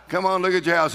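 ordinary talk, nothing marked

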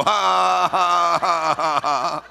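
loud, sustained, deliberate "ha ha ha"; defiant mock laugh. Shown as a demonstration. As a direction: answer the devil with a put-on laugh. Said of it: "You go".

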